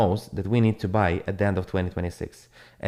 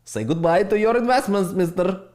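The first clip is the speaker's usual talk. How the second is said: higher, mocking taunt in another voice; mock farewell to a loser investor; 8 semitones higher than usual. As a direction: tease them goodbye, sing-song and smug. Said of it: "It was like".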